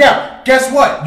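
loud, slow call-out of guess what; emphatic shout. Real, not acted. Parent on child questions, slow loud guess what. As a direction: throw it at them like a challenge.

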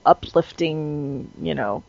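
ordinary talk

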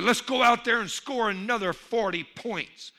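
lightly acted coach's rallying shout; coach's yell. Coach urging his team on to score, mild yell. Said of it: "the coach for the big animals yelled".

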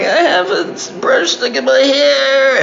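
high, slow mock weepy child; imitating a tearful daughter; whiny little girl voice. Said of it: "Bonnie was like".

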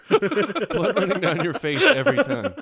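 cracking up at the joke; laughing. Real, not acted. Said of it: "repeats phrase, laughing".

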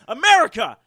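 loud, punchy call-out; loud comic rant at America; ranting catchphrase. Acted. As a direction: shout the segment slogan at the nation.

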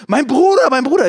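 mock yell, high and loud; nachgeahmtes Schreien. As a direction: shout it like the pushy girl. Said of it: "während sie so schreit". (hohes, lautes Nachäffen der Schreienden)

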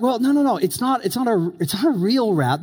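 high, quick backpedal; hasty correction. Retold or imitated. Pastor scrambling to calm scared villagers, high and hurried. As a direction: rush to undo the scare.

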